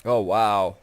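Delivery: loud startled exclamation; taken aback, loud; surprised. Real, not acted. Sudden heavy damage in game.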